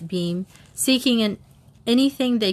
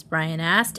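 plain reading voice

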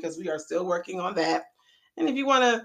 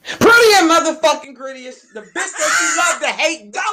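loud hype intro shout; hype announcer; 6 semitones higher, 11 dB louder than usual. Acted. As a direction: announce yourself like a hype man. Hyped intro of the host.

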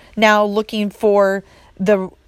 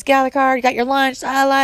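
higher-pitched, hurried-parent nagging, only mildly yelled; mock yelling; 6 semitones higher, faster than usual. Harried mom hurrying kids out of the car, mock yelling. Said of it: "You're yelling at your kids".

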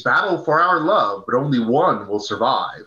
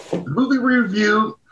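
high strained hoarse voice; struggling hoarse voice; voice cracking; 8 semitones higher than usual. Real, not acted. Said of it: "I'm not talking well".

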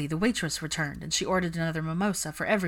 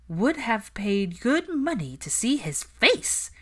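higher, drawn-out amusement; chuckling; 6 semitones higher, slower than usual. A narrator's reading. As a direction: relish it slowly, amused. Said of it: "Susan chuckled".

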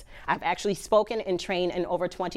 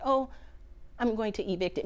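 mild, softer throwaway delivery; calm; 2 semitones higher, 6 dB quieter, faster than usual. Shown as a demonstration. Speaker showing audience how not to say evict, calm. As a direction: say it calmly, as if it were no big deal. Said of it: "just like this calm word".

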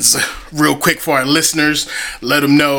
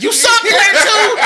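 heckler voice. Retold or imitated. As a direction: jeer from the crowd in a squeal.

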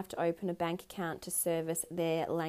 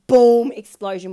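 much louder burst on boom; sudden boom; 3 semitones higher, 18 dB louder than usual. Shown as a demonstration. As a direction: blast it out like an explosion. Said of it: "I'm, like, raging from the inside out".

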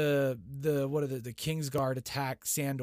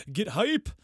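higher and louder, a quick rallying yell; hype shout; 8 semitones higher, 6 dB louder than usual. Real, not acted. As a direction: pump up the crowd. Hyping up the big fight.

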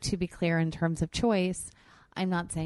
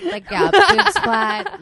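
much higher, louder, slow laughing echo; laughing squeal; 13 semitones higher, 13 dB louder, slower than usual. Real, not acted.

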